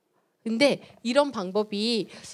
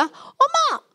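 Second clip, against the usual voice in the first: shrieking, much higher and louder scolding; angry mother; 11 semitones higher, 6 dB louder than usual. Acted. Mother scolding her child for drawing on the wall, loud and high. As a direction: explode at the child over the scribbled wall. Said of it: "엄마의 감정을 다 쏟아버렸어요".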